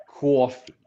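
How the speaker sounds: loud New York "coffee"; New York accent. Shown as a demonstration. Showing things you have to say, loud New York accent. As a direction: hit "coffee" with a rounded New York vowel. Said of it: "like, a New York accent".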